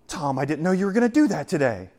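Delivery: high, surprised gasp on the Oh; a mock-surprised God. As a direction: play God caught off guard, mocking. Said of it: "he's not up there going".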